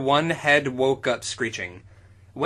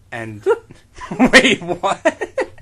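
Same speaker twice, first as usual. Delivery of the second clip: laughing; 5 semitones higher, 7 dB louder, much slower than usual. Real, not acted. Reader stumbles over bad grammar.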